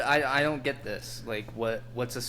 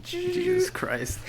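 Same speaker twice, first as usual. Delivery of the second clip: exasperated; slower than usual. Real, not acted. Exasperated reaction.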